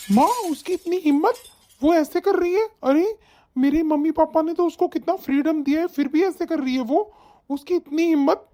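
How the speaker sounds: indignant outrage. Acted. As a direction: bristle in disbelief on a mother's behalf. Son outraged at wife's gossip. (आक्रोश भरी हैरानी)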